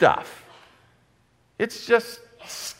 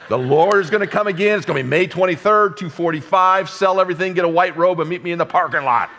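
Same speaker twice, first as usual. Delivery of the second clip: higher, louder mock doomsayer announcing the date; weird doomsday prophet; 6 semitones higher, 4 dB louder than usual. Preacher mocking a wild-eyed doomsday believer, higher and louder. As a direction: ham up a wild-eyed end-times caller. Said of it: "some people get weird".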